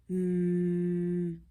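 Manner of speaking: loud steady hum; fridge hum. Acted. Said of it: "went the refrigerator".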